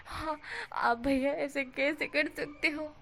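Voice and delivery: lightly voiced sulky boy; whiny reproach. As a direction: stretch the complaint out like a pouting kid. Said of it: "wing इस तरह से बोलता है".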